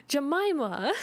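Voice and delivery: high, scandalized cry of the name; flustered exclamation. A narrator's reading. Mother reacting to Jemima out for blood, flustered exclamation. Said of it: "grew more and more flustered".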